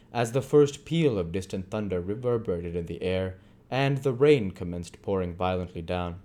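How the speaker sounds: ordinary delivery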